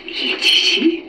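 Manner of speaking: whispering while hiding. Acted. Therapist whispers back to child.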